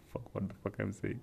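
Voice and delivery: normal talking voice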